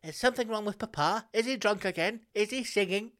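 put-on high, prim little-child voice; posh child. Child asking the mother what's wrong, in a high posh voice. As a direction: ask it like a worried posh kid.

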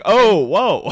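big stunned reaction, played up; mock astonishment. Podcaster acting out the shock of the reveal, mock astonishment.

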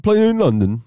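high-pitched mock London accent; attempted English accent. Shown as a demonstration. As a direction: put on a bad English accent. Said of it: "impersonate your best, uh, English accent".

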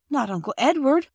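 put-on shocked little girl correcting her mother. A narrator's reading. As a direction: gasp it out, scandalised little girl. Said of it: "said Phyllis in a shocked tone".

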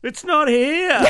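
high shout; yelling across the room. Pretend. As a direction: call it high and loud like a brat. Spoilt son yelling.

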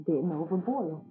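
panting after running back; out of breath. Real, not acted. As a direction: talk while catching breath. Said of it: "Sorry, I catch my breath, 'cause I ran back".

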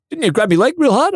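very high-pitched retold question; mock querying. Teammate questions the secret leg signal, mock querying. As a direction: jump the pitch right up, imitating a puzzled mate.

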